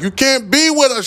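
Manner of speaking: high-pitched mock girlfriend voice; imitating her. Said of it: "She was like".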